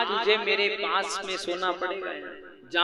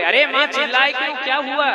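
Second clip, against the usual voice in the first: startled annoyance; 5 semitones higher, 6 dB louder than usual. Acted. King jolted awake, questions mother.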